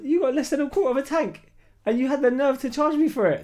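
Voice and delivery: high, loud outraged complaint; loud indignant. Retold or imitated. Retold confrontation over fuel.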